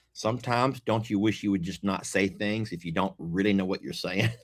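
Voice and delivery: ordinary talk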